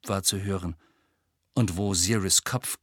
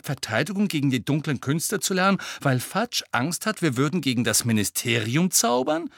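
high, quick outraged protest; appalled disbelief, rushing upward; indignant; 6 semitones higher than usual. A narrator's reading. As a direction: argue it in shocked indignation, pitch raised. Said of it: "hellauf empört".

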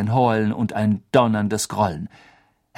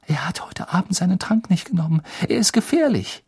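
frightened; 7 semitones higher, faster than usual. Acted. Hermine warns of the werewolf.

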